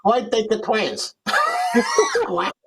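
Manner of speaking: De Niro mob boss. Shown as a demonstration. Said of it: "Tell me to say something".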